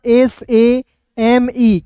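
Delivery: slow, drawn-out letter names; slow spelling. Shown as a demonstration. Teacher spelling same for learners, slowly letter by letter. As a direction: stretch each letter as you spell.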